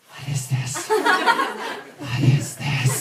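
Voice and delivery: fluisterend en wantrouwig; suspicious whisper. Retold or imitated. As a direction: whisper it like you distrust the book. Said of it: "pick up copies of Harry Potter like".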